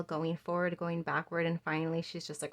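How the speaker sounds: ordinary talk, nothing marked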